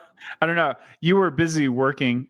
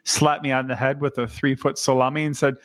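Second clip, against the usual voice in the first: usual speaking voice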